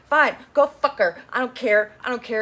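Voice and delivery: mock angry snap. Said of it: "But she's like".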